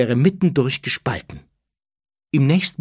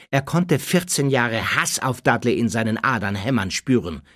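plain reading voice

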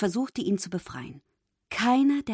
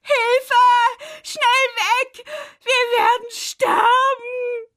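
panicked screaming; 8 dB louder, much slower than usual. A narrator's reading. Fish fleeing the shark.

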